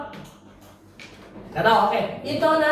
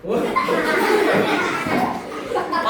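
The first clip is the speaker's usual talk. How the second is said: breathy laugh; 4 semitones higher than usual. Real, not acted. Laughing at student's angry face. (tawa pelan tertahan)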